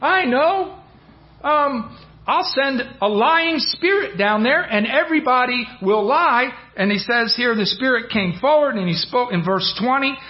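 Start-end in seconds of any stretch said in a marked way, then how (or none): 0.0-6.5 high, eager, bright idea just struck; perked up. Retold or imitated. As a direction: pipe up like the answer just came to you. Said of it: "perks up".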